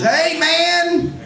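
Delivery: high mock call from across the lot. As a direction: shout it across a parking lot. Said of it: "here I hear this".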